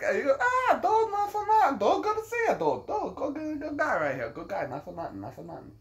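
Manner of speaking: very high put-on voice, tumbling down by the end; imitated excited greeting. Interpreter greets an old friend, imitated excited squeal. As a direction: gush in a squeaky mock voice.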